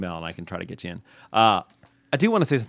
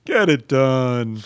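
mock cheering; 4 semitones higher, 8 dB louder, slower than usual.

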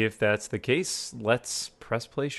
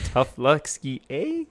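put-on slow Canadian accent, "eh" flipped high; mock Canadian Russian; 4 semitones higher, slower than usual. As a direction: joke Canadian twist, ending on a high "eh". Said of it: "Canadian Russian".

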